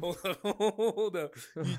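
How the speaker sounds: slow, high laughing hold on. Real, not acted. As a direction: break up laughing while stopping him. Laughing interruption.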